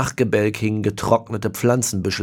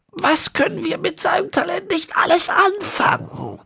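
ancient villain. A narrator's reading. Ezekiel explaining the horse bones, high and whispery. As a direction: rasp out the words like a centenarian.

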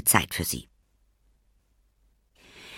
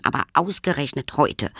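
ordinary delivery

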